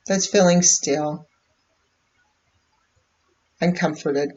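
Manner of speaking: unhurried meditation voice with long pauses; calm, measured guidance, leaving space between phrases; guided meditation. Real, not acted. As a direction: guide listeners gently inward, phrase by phrase. Leads a live meditation.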